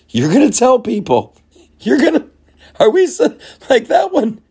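high, loud disbelieving squeal; incredulous squeal. Real, not acted. Incredulous at the claim.

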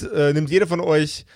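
ordinary talk

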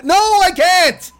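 high, drawn-out cry of refusal; slow, high-pitched exasperation; exasperated mock refusal. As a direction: refuse in an exaggerated high wail. Exasperated answer in mock exchange.

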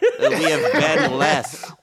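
slow, laughing pun. Real, not acted. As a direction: laugh out the joke.

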